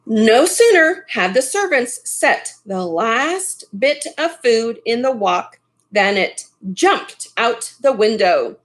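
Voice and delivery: ordinary delivery, nothing marked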